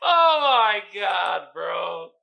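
long, loud "oh my God"; slow holler after laughing; amused exclamation. Real, not acted. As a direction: draw it out slowly and loudly. Recovering from a laughing fit.

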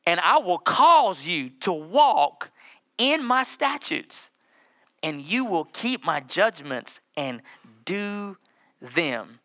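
slow, drawn-out reading of the verse; slow scripture reading. Acted. Pastor reads scripture to the church, slow and deliberate. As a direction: lean into each word like holy text.

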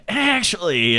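a hint of Columbo; Columbo-style detective. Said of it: "the way that she treats the".

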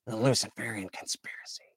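hushed, breathless whisper for a dark secret; conspiracy whisper. Acted. Whispering to listeners about a secret conspiracy, hushed.

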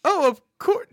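high, loud, chirpy put-on voice; a sugary customer-service voice; over-the-top barista voice. Shown as a demonstration. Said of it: "No, I'm not gonna do that".

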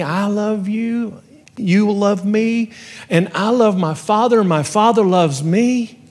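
voice of Jesus. Retold or imitated. Quoting Jesus in a sermon.

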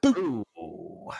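ordinary talk, nothing marked

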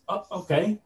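high-pitched, rising okay; acted giddy agreement. Fan told he'll wear every piece, acted giddy agreement. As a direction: agree in a small, lifted voice. Acts out agreeing to full suit-up.

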